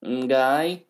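exaggerated Australian bloke; mock Australian accent. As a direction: play a rowdy Aussie lad. Riffing as Aussies.

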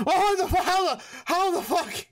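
high-pitched stammer of disbelief; baffled shock. Acted. Startled captor reacts to freed hands, high baffled stammer. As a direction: sputter it, utterly stunned. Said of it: "And he goes".

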